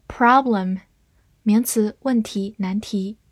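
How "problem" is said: "problem" in an American accent; American pronunciation. Shown as a demonstration. Teacher showing learners the word "problem", American pronunciation. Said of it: "美式发音".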